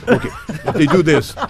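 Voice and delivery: a hint of Spanish accent, playful dance teacher. Dance teacher warning the audience girl, light Spanish accent. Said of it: "in my Spanish accent".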